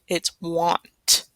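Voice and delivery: normal talking voice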